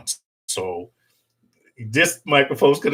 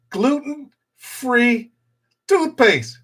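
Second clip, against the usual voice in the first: higher and slowed, drawn out in disbelief; mock disbelief; 9 semitones higher, much slower than usual. Real, not acted. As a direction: spell it out like it's absurd. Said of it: "repeating in disbelief".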